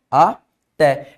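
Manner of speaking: "até" drawn out as two separate syllables; split into syllables. Shown as a demonstration. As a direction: break "até" as a, pause, té. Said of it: "eu separo assim".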